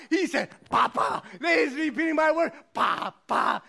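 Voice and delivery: high, proud crowing; excited father. Acted. Neighbor boasting baby repeats his word, excited crowing. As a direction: crow it like a delighted dad. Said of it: "that boy was excited".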